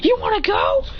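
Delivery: high rising dare; fighting challenge. Acted. Acted challenge repeated.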